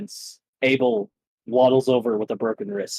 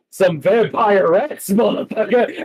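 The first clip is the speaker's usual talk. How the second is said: battle taunt; 7 semitones higher, 4 dB louder than usual. Acted. Kong throwing rats at the dragon, a high loud taunt. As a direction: hurl it like a war cry.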